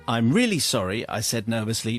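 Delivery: ordinary talk, nothing marked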